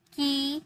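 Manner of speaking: की with a held ee vowel; pronunciation model. Shown as a demonstration. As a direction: model की as a lone word with long ee. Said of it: "हम इसे क्या बोलेंगे". (की में लंबी ई का उच्चारण)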